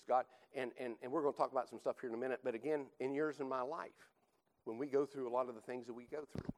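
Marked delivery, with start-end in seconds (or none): none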